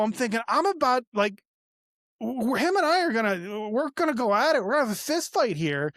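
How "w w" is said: ordinary talk, nothing marked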